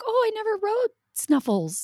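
put-on high-pitched little kid's voice; voiced young daughter. Mom imitating daughter seeing the horse, high child voice.

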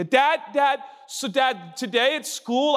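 put-on eager little kid tugging at a parent; a hint of an excited child. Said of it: "you go".

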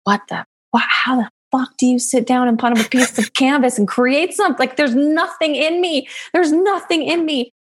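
exasperated disbelief. Retold or imitated. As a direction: throw up your hands, nothing comes. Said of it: "And I'm like".

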